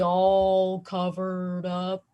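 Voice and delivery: words stretched out in slow motion; mock slow-mo drawl. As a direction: imitate the boss dragging each word. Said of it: "he would get really slow motion".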